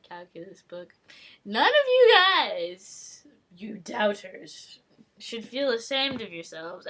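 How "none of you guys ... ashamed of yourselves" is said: mock gloating. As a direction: rub it in to the doubters, drawn out. Gloating at doubters.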